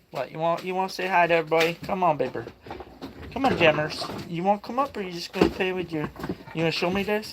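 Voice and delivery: soft sweet-talk to a shy dog. Real, not acted. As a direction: coax the dog up gently, like talking to a puppy. Coaxing his dog to come up.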